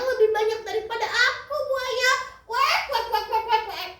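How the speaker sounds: high-pitched, stammering duck with frantic quacks; terrified duck. Acted. Duck begs crocodile not to eat him, high frightened pleading. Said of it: "Bebek pun menjerit ketakutan".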